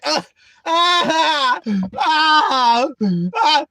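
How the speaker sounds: high shouted crying wails; mock child tantrum cries; toddler tantrum wail.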